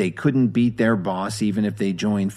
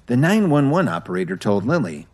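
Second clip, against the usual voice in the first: usual speaking voice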